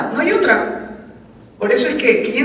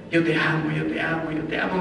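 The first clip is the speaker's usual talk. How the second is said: hushed, tender, much softer; soft loving murmur; 6 semitones lower, 9 dB quieter than usual. Pretend. Husband soothing his angry wife, soft tender murmur. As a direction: murmur it lovingly under your breath.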